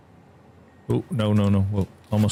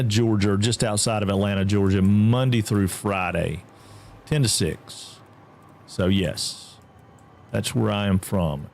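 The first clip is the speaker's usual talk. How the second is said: ordinary talk, nothing marked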